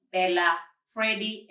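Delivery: usual speaking voice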